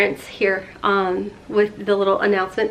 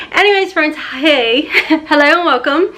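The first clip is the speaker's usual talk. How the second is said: ordinary talk, nothing marked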